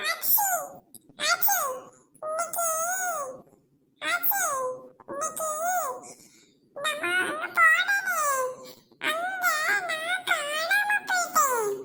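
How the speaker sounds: put-on high, slow, tearful child voice; small girl crying to her granny about getting lost; crying little girl. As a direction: sob it out like a small scared child.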